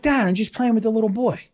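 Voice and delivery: put-on high, quick little kid's voice; little boy voice. Young son explaining to his dad, childlike and innocent. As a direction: sound like an innocent six-year-old. Imitating young son's reply.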